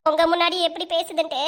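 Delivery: ordinary talk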